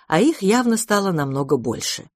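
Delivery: ordinary delivery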